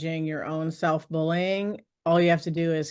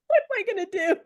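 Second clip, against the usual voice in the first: high, laughing self-question; laughing panic; 14 semitones higher, faster than usual. Retold or imitated. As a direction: laugh through the worry.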